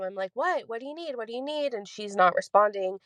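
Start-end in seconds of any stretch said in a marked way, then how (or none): 0.3-1.7 high-pitched call back without real volume; half-voiced, higher reenacted yell; mock yelling back. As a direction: imitate hollering to mom downstairs, held back. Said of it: "I'm yelling back to my mom".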